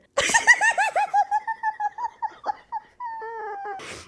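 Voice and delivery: very high squealing laugh, half sob; high mock-crying laugh. Real, not acted. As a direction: squeal into a long tearful giggle. Mock agony over forehead kiss.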